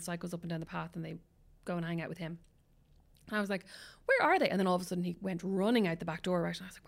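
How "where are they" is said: higher and louder, rising panic; alarmed shout. Retold or imitated. Mother searching for her missing boys, alarmed shout. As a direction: shout it as the worry hits.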